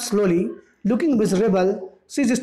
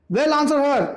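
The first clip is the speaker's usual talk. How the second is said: higher, hammed-up fierce order; fierce mother, pitched up; 6 semitones higher, much slower than usual. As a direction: bark the order at the daughter.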